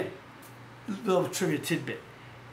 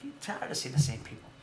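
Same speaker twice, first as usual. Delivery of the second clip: quoting Scott softly; 3 semitones lower, 8 dB quieter than usual. Retold or imitated. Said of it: "he said".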